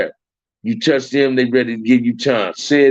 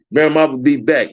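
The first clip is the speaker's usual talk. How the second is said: ordinary talk, nothing marked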